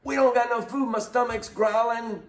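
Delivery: high petulant whining about hunger; whiny complaint. Retold or imitated. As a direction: whine like a hungry kid. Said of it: "They're like".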